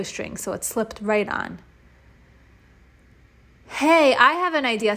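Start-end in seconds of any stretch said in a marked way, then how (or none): 3.7-4.9 eager. Acted. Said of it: "said Ryan".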